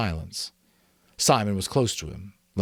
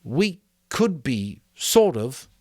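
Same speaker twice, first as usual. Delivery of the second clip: higher and slow, groping for words; hesitant Simon; 6 semitones higher, 4 dB louder, slower than usual. A narrator's reading. Simon to the assembly, slow and hesitant. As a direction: say it carefully, unsure.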